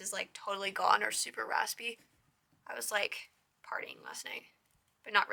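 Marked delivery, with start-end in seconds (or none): 3.6-4.4 hushed, lower aside; whispered joke. Real, not acted. Telling viewers why her voice is raspy: playful whisper. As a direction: drop to a secretive whisper for the joke.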